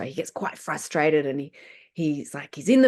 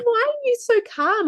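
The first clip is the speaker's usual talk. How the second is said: put-on higher, puzzled kid's question; child's voice; 4 dB louder than usual. Son asks his mum why she is calm, a light child's voice. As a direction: ask it like a surprised little boy. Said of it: "He said".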